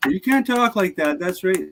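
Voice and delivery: high-pitched mimicked scolding; mock objector. People objecting to his accent, high mock-scolding voice. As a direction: imitate a complainer in a high voice.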